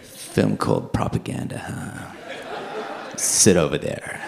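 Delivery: dropped low and dry, knowing suspicion; suspicious officer. Retold or imitated. Interviewing officer eyeing him, low and suspicious. Officer reacts to film title.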